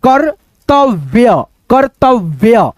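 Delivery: कर्तव्य drawn out slowly, half र before त; slow syllable demo. Shown as a demonstration. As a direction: stretch कर्तव्य slowly for the class. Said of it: "यहाँ पे देखो जैसे कर्तव्य". (कर्तव्य को धीरे धीरे खींचकर बोलो)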